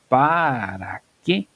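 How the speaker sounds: "para qué" stretched out very slowly; emphatic "para qué". Shown as a demonstration. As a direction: draw out each syllable of "para qué" for emphasis. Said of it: "Estás diciendo". ("para qué" alargado y enfático)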